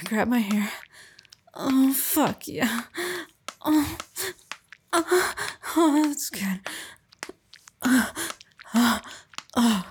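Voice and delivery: high loud pleasure moans; loud moaning. Acted. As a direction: cry out in ecstasy. Moaning in climax.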